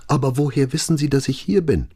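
high, quickened anxious question; creeping panic. A narrator's reading. Maria questions Brunetti about finding her, panic creeping in. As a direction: panic slipping into the voice. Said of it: "die Panik hörte, die sich in ihre Stimme schlich".

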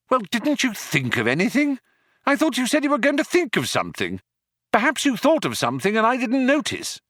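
high-pitched, rapid, rising complaint; agitated questioning. Acted. Stranded man badgers his companion for a plan, agitated. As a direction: press him in a high, hurried voice. Arthur frantically challenges Ford for ideas.

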